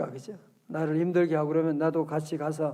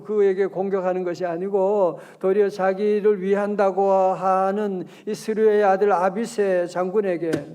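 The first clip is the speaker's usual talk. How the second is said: normal talking voice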